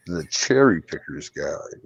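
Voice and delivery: low, slow and smooth, savoring each word; audible chocolate; deep velvety voice. Shown as a demonstration. For friends who begged him to say it, a low slow velvety voice. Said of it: "hear me say it with my audible chocolate".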